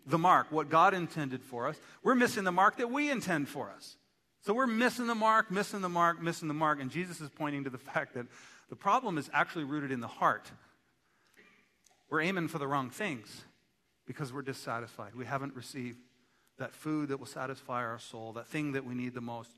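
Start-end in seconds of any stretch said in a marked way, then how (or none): none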